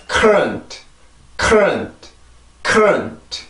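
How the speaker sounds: current, stressed first syllable, t barely touched; model reading. Shown as a demonstration. Teacher repeating current for students to copy. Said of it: "다시 읽어볼게요".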